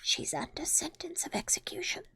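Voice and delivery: soft, secretive whisper. A narrator's reading. Rabbit confiding in Alice, whispered. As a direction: whisper it close to the ear. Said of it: "and whispered".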